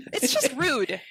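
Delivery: indignant. Real, not acted. Speaker complaining about the raw turkey gift, indignant. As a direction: call it out, offended.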